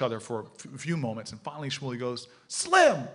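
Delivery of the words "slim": high, loud imitated holler; retold sharp high call. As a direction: shout a name as if calling someone back.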